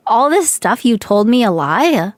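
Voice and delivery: ordinary talk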